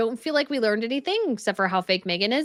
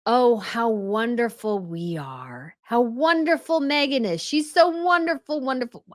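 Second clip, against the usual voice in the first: syrupy gushing; 3 semitones higher, slower than usual. Acted. Host mocking how they praise Megan, syrupy gushing. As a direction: parody sugary admiration, drawing out the praise. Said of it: "fluffy, nonsense, syrupy".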